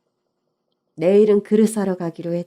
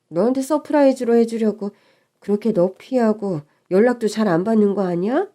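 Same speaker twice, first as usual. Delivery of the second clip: usual speaking voice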